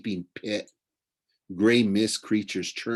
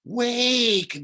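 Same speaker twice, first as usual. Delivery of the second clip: high, loud call to wake; high loud ghostly call; ghostly cry; 13 semitones higher, 3 dB louder than usual. A narrator's reading. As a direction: cry out to rouse him. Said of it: "dead whispered".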